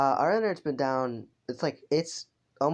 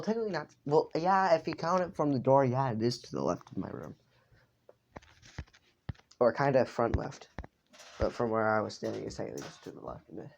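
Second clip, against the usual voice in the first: ordinary delivery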